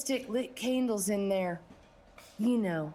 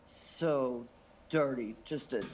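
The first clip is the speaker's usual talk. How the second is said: scornful boss; 8 semitones lower than usual. Acted. Boss calling Candy a dirty-mouthed strumpet, low and lingering. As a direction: draw out the scorn in a low pitch.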